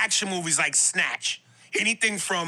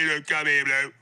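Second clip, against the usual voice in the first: mock British accent; 6 semitones lower than usual. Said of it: "them English movies".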